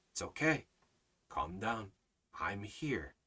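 low, soft, calming voice; reassuring Almighty Father. Acted. God to his worked-up children, low and soothing. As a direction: settle them gently, with space between phrases. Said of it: "What does our, our Almighty Father say?".

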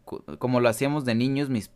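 usual speaking voice

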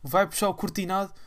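usual speaking voice

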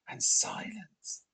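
slow, soft whispered narration; hushed whisper. A narrator's reading. Narrator as the lights go out, whispering. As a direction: drop into a suspenseful hush. Narrator evokes darkness and silence.

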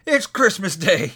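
high, slow exclamation; delighted realization. A narrator's reading. Scrooge saying to himself, delighted realization. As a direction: savour it with delight. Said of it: "Scrooge said to himself".

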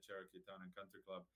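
ordinary talk

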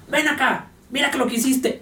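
high-pitched mock scolding of the dog; imitating an owner scolding the puppy, pitch up.

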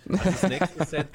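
laughing while answering; bursting into a laugh. Real, not acted. Lacht los beim Antworten.

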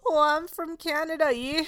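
high, bright mock Canadian; Canadian accent. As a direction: put on a hammy Canadian accent. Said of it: "her Canadian accent".

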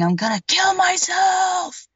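high, desperate cry, dragged out slowly; despairing shout. Retold or imitated. Father pulling his hair out, screaming at the window in despair. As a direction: scream it like a man at his breaking point.

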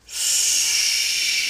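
long sibilant snake hiss; drawn-out "sss". Acted.

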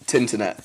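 "Tinternet", the word "the" cut to a T; northern contraction. Shown as a demonstration. Explaining Lancashire and Yorkshire speech, demonstrating "Tinternet" as one word. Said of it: "it'll just be like".

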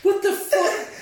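high, loud incredulous laughing; can't believe what he saw; amazed. Real, not acted. Friend reacting in disbelief to the chainsaw scene, high and laughing.

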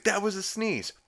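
high-pitched objection; mock protest. [name] objecting to the judge, high mock protest.

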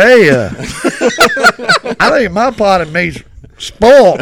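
loud, laughing through a buddy's puzzled complaint; laughing buddy quote. Retold or imitated. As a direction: crack up while quoting the worried friend. Buddy finds spoiled-looking meat.

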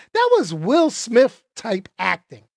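normal talking voice

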